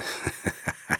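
low-volume, insinuating chuckle; brief leering laugh, acted; suggestive laugh. A narrator's reading. Brother startles the lawyer in the dark, suggestive chuckle. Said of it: "anzüglich lachte".

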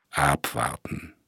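quiet, slow and steady order; calm command. A narrator's reading. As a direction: keep it calm and unhurried. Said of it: "befahl der Inspektor mit ruhiger Stimme".

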